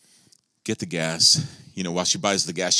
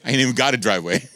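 joking aside; 5 semitones higher, 6 dB louder, faster than usual. Acted. Joking aside about own driveway.

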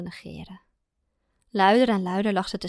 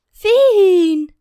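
high, loud whining call of a name; drawn-out nagging call; whiny call; 11 semitones higher, 14 dB louder than usual. A narrator's reading. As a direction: whine the name from the next room. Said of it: "klonk er jengelend".

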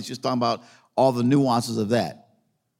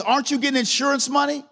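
high, quick, insistent query; imitated pressing question; 12 semitones higher than usual. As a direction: mimic someone pushing for an answer. Pastor presses him, acted out.

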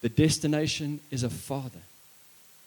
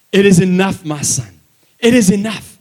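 the Father's loud answer; 4 semitones higher, 12 dB louder than usual. Acted. God the Father answering Jesus.

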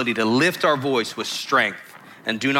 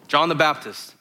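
ordinary delivery

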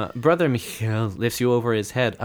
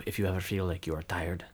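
whispered warning; eerie whispered taunt; mock game whisper; 5 semitones lower, 11 dB quieter than usual. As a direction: breathe it like a secret tutorial hint.

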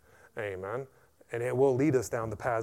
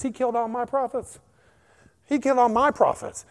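indignant outrage; 9 semitones higher, 5 dB louder than usual. Acted. Acts Jezebel's outraged reply.

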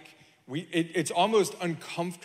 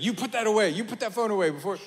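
higher, louder imitation of a scolding; mock scolding wife; 5 semitones higher, 3 dB louder, faster than usual. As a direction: act out a wife's telling-off. Acts out wife scolding about phone.

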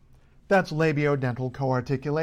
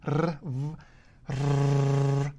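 trilled R buzzing through the lips; rolled R with V; much slower than usual. Shown as a demonstration. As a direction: roll the R while biting a V. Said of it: "Roll an R while saying a V".

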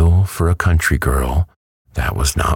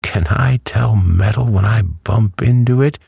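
higher-pitched gloating hen; triumphant; 8 semitones higher, 3 dB louder than usual. A narrator's reading. Hen shows Dorothy it was metal, triumphant. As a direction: press the point home in triumph. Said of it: "with a cackle of triumph".

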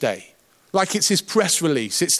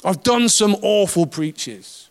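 usual speaking voice

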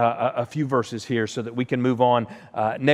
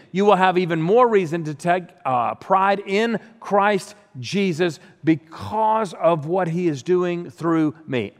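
slow, raised, earnest reading of the verse; scripture reading; 4 semitones higher, 3 dB louder than usual. Acted. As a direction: read it like the living word, slow and full. Reads scripture aloud.

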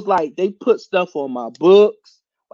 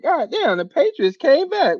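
retold shock; 7 semitones higher than usual. Own shocked reaction retold.

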